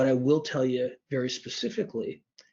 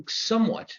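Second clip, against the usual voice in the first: somewhat said high and loud, leaning on it; emphasized word; 9 semitones higher, 3 dB louder than usual. Real, not acted. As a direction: hit the word somewhat hard. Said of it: "I emphasize the word somewhat".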